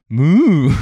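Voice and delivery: an imitation of a man who cannot moo; failed moo. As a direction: moo badly, like someone who can't do it. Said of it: "he just kept going".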